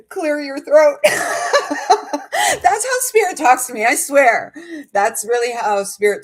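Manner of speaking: high, loud laughing through the words. Real, not acted. To her viewers, amused by spirit's rhymes, laughing. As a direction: crack up at her own rhyme.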